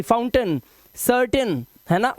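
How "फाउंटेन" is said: फाउंटेन as "faun-tən", short final vowel; correct pronunciation. Shown as a demonstration. Teacher modelling the "un" sound, correct pronunciation. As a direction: end फाउंटेन on a quick "un" sound. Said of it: "pronunciation रहेगा अन की".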